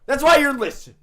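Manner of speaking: high, loud taunting yell; mock shout. To listeners, boasting about his thoughts, loud gloating shout. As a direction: gloat at them at full volume.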